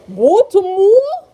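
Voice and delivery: put-on high, drawn-out Baltimore accent with rounded vowels; mock Baltimore accent. Teasing Baltimore people about how they talk, mock Baltimore accent. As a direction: exaggerate a Baltimore accent, stretching it into a rising question. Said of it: "Y'all accent be crazy".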